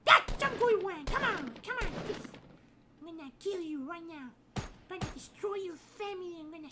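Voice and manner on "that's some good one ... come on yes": victory cheer. Acted. Celebrating a kill.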